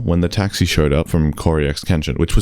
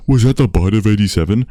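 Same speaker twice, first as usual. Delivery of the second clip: mock yell; 4 semitones higher, 4 dB louder than usual. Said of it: "everyone yells".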